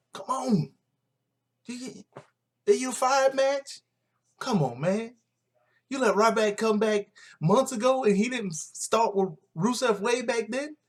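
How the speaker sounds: high-pitched pleading rant at the writers; fed-up fan squeaking complaints at the writers; exasperated mock whine. Ranting at the WWE writers.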